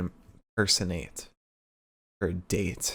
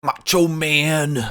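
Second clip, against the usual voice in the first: slow, higher gravelly wrestler drawl; Macho Man voice; 6 semitones higher, 3 dB louder, much slower than usual. Retold or imitated. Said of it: "if we're talking Attitude Era".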